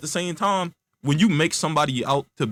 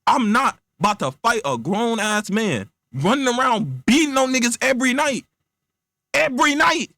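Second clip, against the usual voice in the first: rising, high-pitched refusal; exasperated protest; 5 semitones higher than usual. Acted. Friend refusing to fight Batman, building exasperation. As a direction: build to a squeal of acted disbelief.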